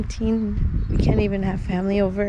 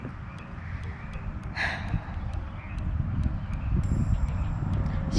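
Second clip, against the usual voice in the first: long sad sigh; 6 dB quieter than usual. Real, not acted. Sad over lost bird.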